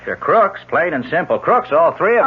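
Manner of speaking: angry outburst. Acted. Detective denounces the con artists.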